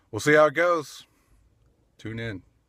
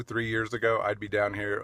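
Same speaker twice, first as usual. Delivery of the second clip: normal talking voice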